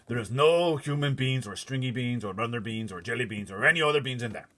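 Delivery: slipped into the bully's voice; wrong giant voice. Acted. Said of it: "Wrong giant voice, sorry".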